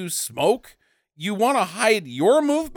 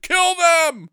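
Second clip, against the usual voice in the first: high, raised comic yell; mock battle cry; 10 semitones higher, 4 dB louder than usual. Villain ordering attack on the vacationers, mock shout.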